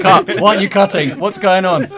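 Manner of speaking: high, loud panicked yelling; frantic shout. Retold or imitated. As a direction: yell it in a panic. Retold panic at projector.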